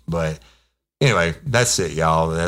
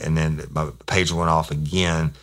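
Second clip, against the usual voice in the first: ordinary delivery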